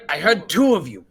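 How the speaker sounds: insistent character voice, high and loud; Shadarkai calling out. Acted. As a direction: call out to those hiding in the forest. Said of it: "behind the Shidarkai voice".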